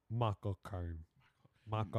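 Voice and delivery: British-accented actor impression, low-key; Michael Caine. Shown as a demonstration. Friend demonstrating the actor's name to friends, British-accented Michael Caine impression. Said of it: "do a Michael Caine impression".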